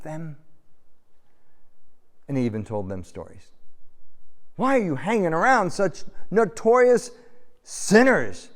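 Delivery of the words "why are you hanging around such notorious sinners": accusing Pharisees. Retold or imitated.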